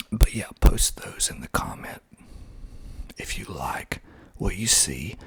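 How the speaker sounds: usual speaking voice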